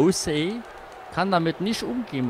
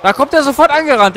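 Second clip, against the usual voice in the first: loud and higher, indignant; outraged shout; 10 semitones higher, 10 dB louder than usual. Real, not acted. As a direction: shout it in disbelief. Opponent rushes in. (empört laut gerufen)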